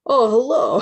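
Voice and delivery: put-on startled hiker says hello in a Brooklyn accent. Reader voices a startled Brooklyn hiker greeting. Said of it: "He said in a rich Brooklyn accent".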